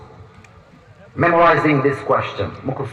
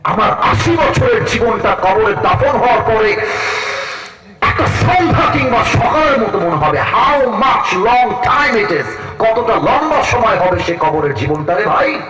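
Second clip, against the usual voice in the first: raised, ringing sermon voice over music; fervent preaching; 10 semitones higher, 4 dB louder, slower than usual. Acted. Preacher tells the crowd about the grave, full-force preaching.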